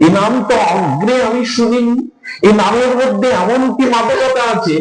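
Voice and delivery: high, wondering voice of Radha. Retold or imitated. Radha asks Lalita about the name, high and wondering. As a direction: ask it high and amazed, like a rapt girl. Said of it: "বলি".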